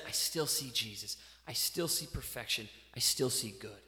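low and soft, fading; as God, quietly forgiving; soft low murmur of forgiveness; tender divine reassurance. Pretend. God looks at sinning believers.

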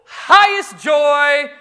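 loud, high, drawn-out delight; joyful dog. Acted. Dog hearing its master's voice, loud slow joy. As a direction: voice an elated dog, stretching the words. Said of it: "she goes, "Oop, highest joy".

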